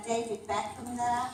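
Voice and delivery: ordinary talk, nothing marked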